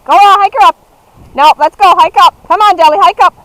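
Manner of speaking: loud urging calls; calling commands. Real, not acted. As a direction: drive the team on. Urging dogs.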